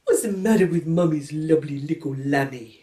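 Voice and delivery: lightly voiced doting mum; a hint of baby-talk coo; cooing mother.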